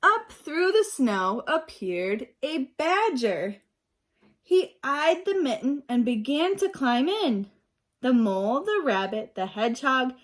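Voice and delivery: put-on reading aloud to young children, lively; storybook reading. A narrator's reading. Teacher reading a picture book to her class, lively. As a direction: bring the story alive for the class.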